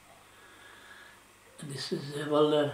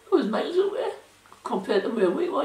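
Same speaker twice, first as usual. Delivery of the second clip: high-pitched, chuckling through the words; amused disbelief, laughing it off; real laugh as he tells it; 9 semitones higher, 4 dB louder than usual. Real, not acted. Amused at the distant hometown.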